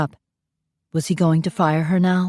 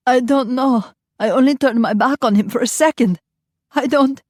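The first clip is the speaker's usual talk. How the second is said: distraught; 7 semitones higher than usual. Acted. Nanny explaining to the mother, distraught. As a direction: blurt it out in guilt.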